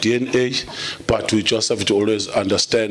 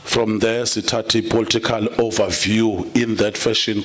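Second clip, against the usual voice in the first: normal talking voice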